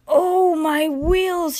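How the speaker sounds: high, slow storybook wail; shivering dismay. A narrator's reading. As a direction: wail it like a little engine in cold water. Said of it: "shivered Percy".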